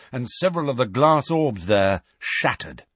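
ordinary delivery, nothing marked

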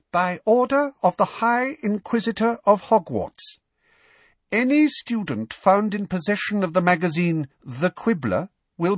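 put-on high, girlish proclamation voice; prim, high-pitched official notice; official decree. A narrator's reading. Umbridge's decree read in her voice.

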